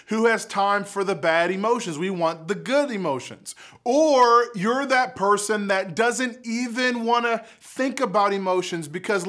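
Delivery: ordinary delivery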